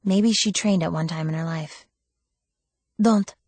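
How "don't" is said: firm, higher and louder warning; blunt. A narrator's reading. Woman warning the girl off her search, blunt. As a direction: cut it off bluntly in an accented voice. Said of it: "she said bluntly".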